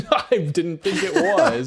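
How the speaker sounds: laughing through the words; slow, laughing reply. Real, not acted. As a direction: giggle while saying it. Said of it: "Now it kinda sounds like it is".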